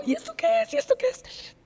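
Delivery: put-on very high squeaky kid's question; high childish mimic of a kid asking what this is. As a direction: squeak it like a curious little kid. Kids passing glasses around.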